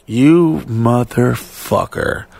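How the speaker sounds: very slow, drawn-out curse; slow seething mock curse; retold resentful curse. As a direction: stretch each word at the culprit. Retells cursing the no-show pilot.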